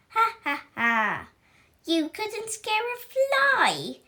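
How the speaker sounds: sneering laugh at the pupil; mocking laugh. Witch teacher mocking Howler with a high jeering laugh. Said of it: "mocked the teacher".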